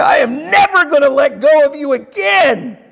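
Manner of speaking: high, loud, emphatic vow; relieved mom. Retold or imitated. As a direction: clutch the child and swear it. Mom finds her lost kid.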